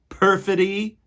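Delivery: a loud, high cry of Perfidy!; dramatic exclaim. Acted. Teacher reading the title with a dramatic cry. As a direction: announce the betrayal with flair.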